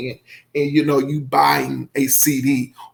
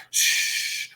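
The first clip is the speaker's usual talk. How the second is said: long soothing shush; imitating a gentle hush for crying babies; mother's hush; 6 dB quieter than usual. Mother walking into a nursery of crying babies, soothing shush. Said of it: "It could be like".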